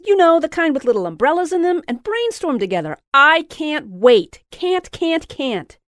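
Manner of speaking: high, loud gushing; can barely contain herself; giddy excitement. A narrator's reading. Louise gushing about the trip to Jessica, high and loud.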